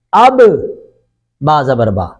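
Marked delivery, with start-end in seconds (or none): 0.1-0.4 اب said as one joined syllable, the با doubled onto the alif; teacher sounding out اب with shadda. Shown as a demonstration. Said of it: "حمزہ کے ساتھ مل کر".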